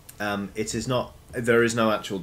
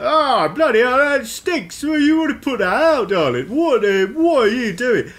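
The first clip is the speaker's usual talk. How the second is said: high, loud indignant mushroom voice; high, loud cranky fungus; grumpy mushroom; 13 semitones higher, 9 dB louder than usual. Acted. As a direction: squawk at them like an offended mushroom. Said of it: "all of these mushrooms be like".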